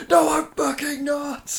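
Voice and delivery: high, slow, indignant shout; angry retort. Retold or imitated. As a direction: bellow the denial. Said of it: "Toga's like".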